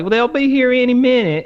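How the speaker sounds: high, breezy clerk brush-off; mocking the clerk. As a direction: brush him off like the store clerk. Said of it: "She's like".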